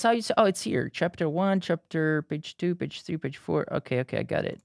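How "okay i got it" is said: normal talking voice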